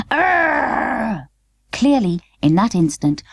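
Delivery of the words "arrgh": rough mock dog bark. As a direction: bark like a frantic little dog. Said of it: "came the sound".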